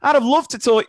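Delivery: teacher playing along with booing kids, high mock plea. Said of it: "you know, playing along with it".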